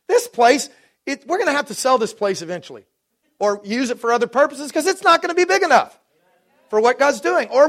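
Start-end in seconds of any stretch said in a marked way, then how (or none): none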